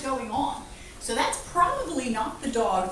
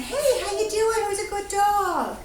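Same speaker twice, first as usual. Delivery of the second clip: gentle polite greeting; 8 semitones higher than usual. Shown as a demonstration. Greeting a calm dog with an outstretched hand, gently. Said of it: "in a dignified, polite fashion".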